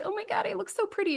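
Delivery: high, fast, overcome bride, hinted at; tearful bride. Retold or imitated. Bride seeing herself in the mirror, a light imitation of a tearful gush. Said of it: "She's like".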